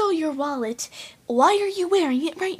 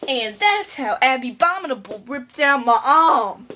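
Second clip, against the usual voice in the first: ordinary talk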